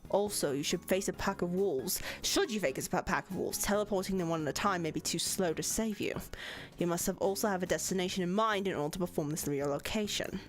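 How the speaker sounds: lightly British professor reading; a hint of a stern British lecturer; British accent. Professor voice continues.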